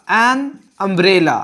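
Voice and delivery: an umbrella drawn out slowly, an stressed; slow model reading. Shown as a demonstration. Teacher modelling the example slowly for students. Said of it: "उसी तरह से यहां पर".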